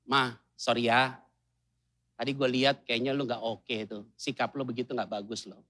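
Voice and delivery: tender husband apologizing to his wife; gentle husband. Retold or imitated. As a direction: coax her kindly.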